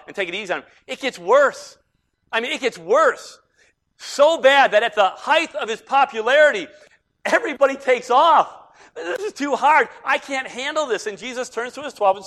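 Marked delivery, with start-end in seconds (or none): 0.9-8.8 loud, high, escalating pulpit story; full-force preaching. Real, not acted. Preacher telling how the crowd deserted Jesus, full-force preaching. 9.0-11.0 high, loud mock complaint; exasperated crowd. Preacher imitating followers deserting Jesus, exasperated mock complaint.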